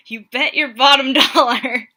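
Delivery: loud belted Broadway orphan line; Annie belting. Retold or imitated. Quoting a musical to answer a Tinder flirt, loud belted Annie voice. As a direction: belt it out like Annie on stage. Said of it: "was from Annie, um, the musical".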